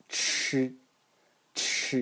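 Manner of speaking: ch, explosion and noise together, tongue curled back; demonstrating Mandarin first-tone chi; Chinese ch. Shown as a demonstration. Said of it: "in the first tone it will be pronounced".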